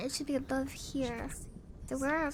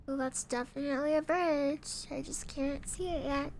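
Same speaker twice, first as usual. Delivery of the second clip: ordinary talk, nothing marked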